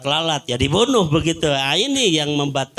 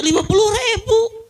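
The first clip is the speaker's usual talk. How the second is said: laughing. Real, not acted. Punchline about riyal prices.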